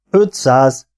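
stunned, swallowing. A narrator's reading. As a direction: repeat it as if hard to swallow. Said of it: "nyelt a lovag".

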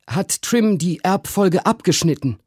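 high-pitched uncle; Uncle Toby's voice. A narrator's reading. Uncle answers his brother about the boots, high-pitched. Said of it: "sprach mein Onkel Toby".

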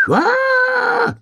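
very high sustained shriek; long high storybook scream; scream through the forest. A narrator's reading. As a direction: wail "aaah" at the top of your range. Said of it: "неслось по лесу".

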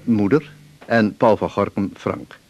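usual speaking voice

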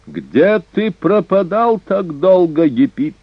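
very high, drawn-out, sharp challenge; insolent demand. A narrator's reading. Barbarian taunting the waiting king, insolent high demand. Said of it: "продолжал дерзкий варвар".

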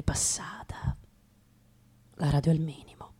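hushed inner voiceover; whispered monologue. Acted. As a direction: whisper it to yourself at midnight.

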